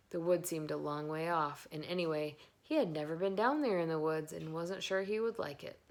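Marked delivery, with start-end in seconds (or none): none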